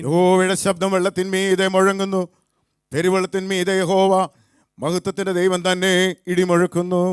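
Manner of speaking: high, loud psalm reading; scripture recitation. Acted. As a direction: proclaim the psalm to lead a congregation. Leads responsive psalm reading.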